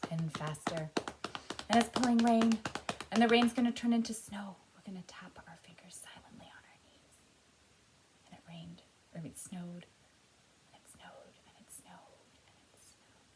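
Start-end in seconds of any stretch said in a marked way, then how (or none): 1.7-4.6 loud, higher, building storm call; loud storm narration. Pretend. Storm sound game builds up. 4.7-13.3 very soft, near-whisper storytelling; hushed snowfall. Pretend. The snow is so quiet.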